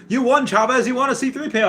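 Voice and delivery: imitating viewers telling him he won; hammed-up excited fans; mock chat fans. I get people on my stream.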